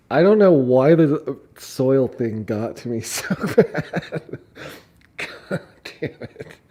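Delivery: can't stop cracking up; laughing disbelief. Real, not acted. Laughing about the soil thing.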